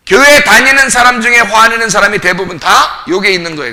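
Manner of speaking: loud, high-pitched preaching, pressing each point home; forceful preaching. Real, not acted. Preacher urging the congregation to empty themselves, forceful preaching. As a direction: preach it hard at full voice.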